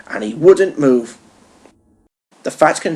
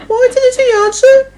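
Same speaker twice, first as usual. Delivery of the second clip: high, puzzled little engine voice; Percy's voice; 5 dB louder than usual. A narrator's reading. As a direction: pitch way up for young Percy.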